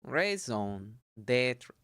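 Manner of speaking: "Raison d'être" very slow, nasal ɔ̃; careful pronunciation. Shown as a demonstration. One careful try before rereading. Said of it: "say it like date".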